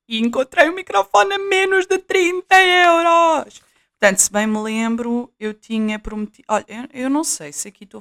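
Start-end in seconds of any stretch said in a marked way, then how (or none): 0.1-3.6 high, loud and a bit slow, thrilled; excited bargain-find reveal. Real, not acted. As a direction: announce the bargain with real delight. Thrilled about a cheap mic.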